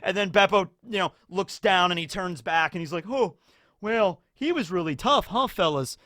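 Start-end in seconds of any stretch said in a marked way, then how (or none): 3.1-6.0 higher, easygoing bloody bear; casual bear. Retold or imitated. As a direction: toss it off casually, unbothered. Beppo voiced after the massacre.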